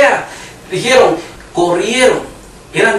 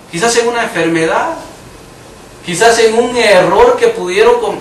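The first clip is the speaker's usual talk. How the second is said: ordinary talk, nothing marked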